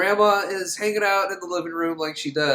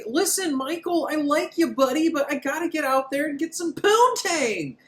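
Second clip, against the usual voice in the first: mock Jody; 11 semitones higher than usual. Jody voiced answering Michael.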